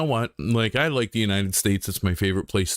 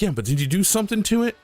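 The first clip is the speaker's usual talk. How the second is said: higher, fast mock girlfriend voice; imitating girlfriend; 10 semitones higher, much faster than usual. Streamer mimics girlfriend pressing about his face. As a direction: pitch up to play her insisting. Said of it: "And she was like".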